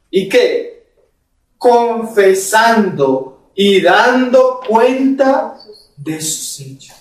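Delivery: lee el pasaje despacio, marcando cada frase; lectura bíblica solemne. Acted. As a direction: proclama la Escritura con peso. Lee la Escritura en el sermón. (lectura solemne del pasaje)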